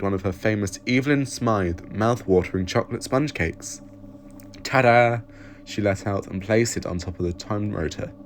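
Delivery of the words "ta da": proud ta-da. Acted. Presenting her cake proudly.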